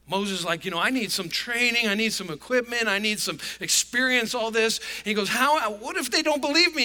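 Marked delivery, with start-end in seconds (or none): 0.7-4.8 louder, higher mock protest; uptight Moses. Moses telling God he is unequipped, mock uptight. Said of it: "Moses is pretty uptight about this". 5.3-6.8 high, loud acted fretting; uptight Moses. Moses fearing nobody will believe him, acted uptight. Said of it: "Moses is pretty uptight about this".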